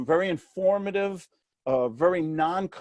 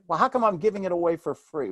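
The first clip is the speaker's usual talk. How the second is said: ordinary delivery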